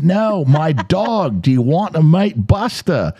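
slow, high mock Australian accent. Friend re-explaining about his dog, slow Australian accent. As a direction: spell it out slowly in broad Australian. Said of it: "So he says it slower".

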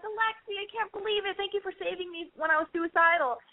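high, breathless gush of gratitude; gushing grateful friend. Pretend. Grown-up friend reunited in New York, high gushing. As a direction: play her years later, overjoyed. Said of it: "you're gonna be like".

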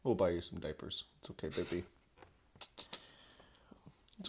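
soft, low soothing coo; calm her like a frightened pet; soothing a scared dog. Pretend. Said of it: "talking to her like a dog".

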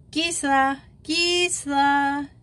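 high, sustained sing-out of a name; calling out. A narrator's reading. Joey calling Gisela over, sung-out hail. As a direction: hail a friend across the yard.

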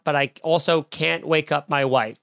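usual speaking voice

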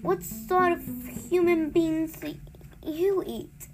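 hesitant, broken up by nervous pauses; trembling. A narrator's reading. Girl asking the giant what he eats, trembling. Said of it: "she asked, trembling".

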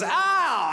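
high-pitched drawn-out "ow"; mock group reaction cry. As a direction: imitate a whole office reacting. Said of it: "Everyone in the office goes".

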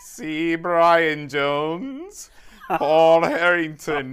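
put-on loud, high muddled British-Aussie accent; stretched-out fake foreign accent; bad foreign accent. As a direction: announce names in a hammed-up fake accent. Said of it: "best foreign accent".

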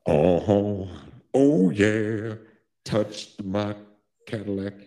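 low crooner, words spaced out; mock rock-and-roll star drawl. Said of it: "you got some [name] vibes going".

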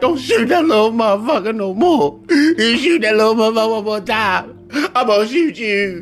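high, frantic whining plea; Arsenio's crying man. Retold or imitated. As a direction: beg at a high pitch, near tears. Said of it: "And Arsenio was like".

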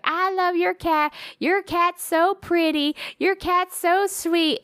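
high, drawn-out mock gushing; imitating a fawning cat lover; gushing cat admirer. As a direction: coo it in a slow, syrupy high voice. Voices fans gushing over cat.

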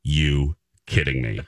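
slow, drawn-out words, each set apart; slow disbelief. Retold or imitated. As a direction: spell out the incredulity word by word. Husband's stunned reaction, retold.

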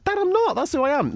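high pitched "Dad, I'm not"; high indignant protest. Retold or imitated. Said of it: "I said".

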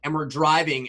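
usual speaking voice

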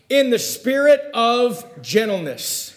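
slow, high, deliberate scripture reading; emphatic verse reading. Real, not acted. Preacher reads the verse to the congregation, emphatic and slow. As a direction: draw the verse out with full voice. Verse read out, then repeated softly.

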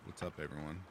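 ordinary delivery

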